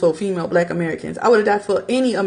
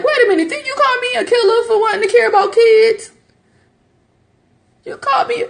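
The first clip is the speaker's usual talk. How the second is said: high, loud mock outrage; indignant retort; 3 dB louder than usual. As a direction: catch them in the hypocrisy. Retort to hypocritical critics.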